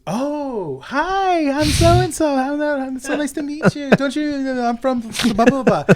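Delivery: high bubbly fake-friendly girl voice; high, loud mock sweetness. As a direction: gush up high like an overeager fan. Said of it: "He goes".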